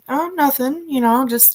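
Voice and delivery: high, breezy imitation of a dismissive killer; mockingly nonchalant father; mock casual denial. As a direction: toss off the denial in a lightly voiced high tone. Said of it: "He just".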